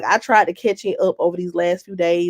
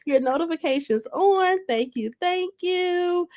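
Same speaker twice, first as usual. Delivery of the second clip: reading a fan comment; 9 semitones higher than usual. Retold or imitated. Reading a fan's comment to viewers in a high, gushing voice. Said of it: "It says".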